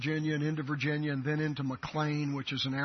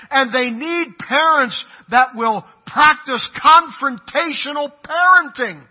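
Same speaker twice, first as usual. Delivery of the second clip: preaching at full force; 8 semitones higher, 11 dB louder than usual. Acted. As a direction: hammer each line home to the congregation. Preacher builds to a climax.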